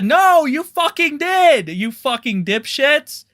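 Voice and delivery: furious outburst; angry rant. Real, not acted. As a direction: tear into them furiously.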